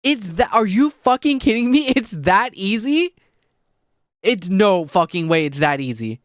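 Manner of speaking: high, loud disbelief; incredulous near-screaming. Real, not acted. Streamer stunned by an easy fix, loud incredulous cry. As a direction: shout it in amazement, stretching the words. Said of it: "I might, like, screaming in the mic".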